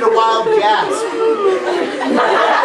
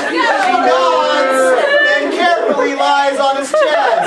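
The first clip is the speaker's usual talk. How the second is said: laughing through it; 4 semitones higher, 4 dB louder, slower than usual. Real, not acted. Rereading while still laughing.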